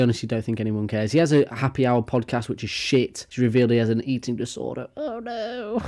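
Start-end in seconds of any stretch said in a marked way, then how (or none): 5.0-5.8 high, sarcastic oh no; squeaky mock pity; mock sympathy. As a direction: fake concern.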